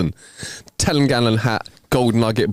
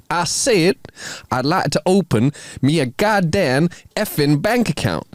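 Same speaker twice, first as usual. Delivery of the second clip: higher, slower, insistent put-on Texas drawl; emphatic Texas drawl; 6 semitones higher, slower than usual. Texan repeating himself to a shocked teller, emphatic Texas drawl. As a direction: repeat it pointedly in a mock Texan twang. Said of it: "repeats himself slightly louder than necessary".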